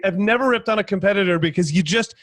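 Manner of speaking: usual speaking voice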